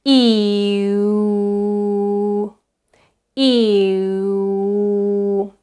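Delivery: "E, oo" as one long, steady glide; gliding vowel drill. Shown as a demonstration. Teacher practising going from E to oo, gliding vowel drill. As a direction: stretch "E" and slide it back into "oo". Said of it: "going from E to oo".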